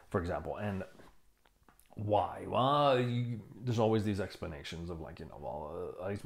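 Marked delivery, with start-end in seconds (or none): none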